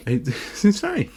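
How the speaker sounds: high, slow laugh through the words; real high-pitched incredulous laughter; laughing disbelief. Real, not acted. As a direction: laugh helplessly as you say it. Laughs at the absurd staff notice.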